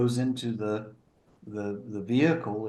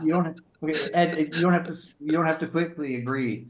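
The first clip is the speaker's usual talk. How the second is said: higher, louder playful protest; mock offended; 6 semitones higher, 4 dB louder than usual. Real, not acted. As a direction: pretend to be hurt, laughing it off. Joking protest at friend's laugh.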